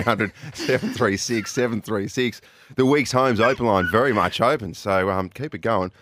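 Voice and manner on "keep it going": normal talking voice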